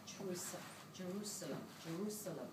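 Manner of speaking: very soft, low repeated call; hushed calling. Acted. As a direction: let the word call softly, like a distant summons. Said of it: "that was calling me".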